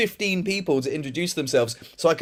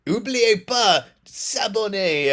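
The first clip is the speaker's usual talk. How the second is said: slow, higher French presenter voice; Benjamin Bronto; 5 semitones higher, slower than usual. Retold or imitated. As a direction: exaggerate each syllable like a French video host. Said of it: "he speaks like that".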